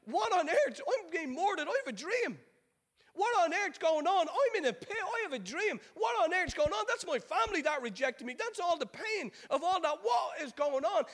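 high, emphatic outcry; exasperated protest. Acted. Preacher voicing Joseph in the pit, exasperated. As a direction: cry it out in bewildered bursts.

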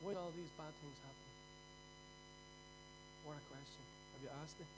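hushed question. Acted. Preacher asking the congregation why bad things happen, hushed. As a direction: let it drop to a hush.

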